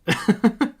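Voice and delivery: loud, very high laughter; real amused cackle; high giggling laugh. Real, not acted. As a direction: burst into high-pitched laughter. Laughs at odd sight.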